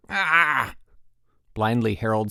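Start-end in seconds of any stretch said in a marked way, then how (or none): 0.1-0.8 high, loud open cry of rage; frustrated scream. A narrator's reading. Harold about to storm off, screaming. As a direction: yell out wordless frustration.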